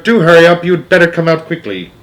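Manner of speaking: loud hurried call; shouting. A narrator's reading. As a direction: call out loudly, hurrying her. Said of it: "it shouted".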